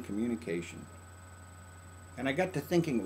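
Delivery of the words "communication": soft echo. Shown as a demonstration. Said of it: "Anyway, communication".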